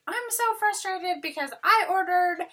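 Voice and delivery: high, mock-angry customer complaint; mad voice. Shown as a demonstration. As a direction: put on a cranky, frustrated customer voice. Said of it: "that's my mad, my mad voice".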